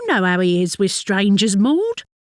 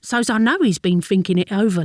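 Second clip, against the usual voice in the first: ordinary delivery, nothing marked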